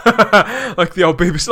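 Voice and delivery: amused, laughing aside. Real, not acted. Amused aside about old BBC.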